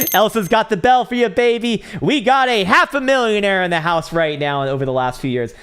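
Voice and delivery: high, loud celebratory hype; excited announcing. Real, not acted. Streamer hyping a viewer's half-million win, loud and high. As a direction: announce it like ringing a victory bell.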